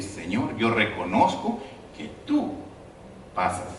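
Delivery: low, soft, slow acknowledgment to God; acted quiet submission; humble prayer. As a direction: pray it gently and haltingly. Models humble prayer after the complaint.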